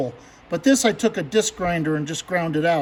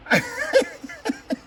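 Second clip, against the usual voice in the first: a high, strained laugh through the sting; high pained laugh; 4 dB quieter than usual. Real, not acted. Nursing his cut finger, high pained laugh. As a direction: laugh it off while it still hurts.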